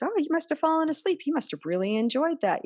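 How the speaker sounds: high, sing-song false reassurance; smooth it over brightly; mock reassuring adult. Adult telling a dazed child, high sing-song reassurance.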